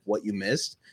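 usual speaking voice

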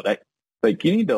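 ordinary talk

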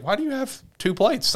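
high-pitched retold question; put-on high voice, questioning; suspicious questioning. Retelling Mio asking about the plates, suspicious.

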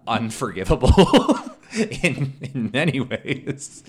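laughs through the verdict; real laughter; laughing. Real, not acted. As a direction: breaks into a loud laugh on the word.

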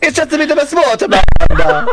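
put-on high, fast Italian accent. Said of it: "Italian accent".

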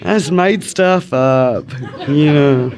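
ordinary talk, nothing marked

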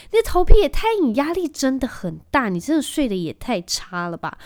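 high, loud and fast at the start, then settling; quoting the shampoo lady's shocked verdict; amazed exclamation. Retold or imitated. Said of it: "他就说". (惊讶的高声感叹)

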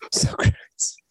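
breathy whisper of the name. Real, not acted. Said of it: "whispered name".

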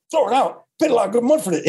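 high, rough Scottish bandmate refusing; a Scottish punk protesting in a Scottish accent. Retold or imitated. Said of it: "In his Scottish accent".

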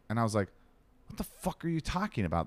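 ordinary talk

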